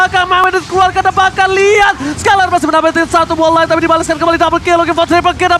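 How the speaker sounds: shouted hype play-by-play, high and loud; fighting game announcer. Real, not acted. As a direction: scream the big play like a caster. Caster hyping decisive team fight.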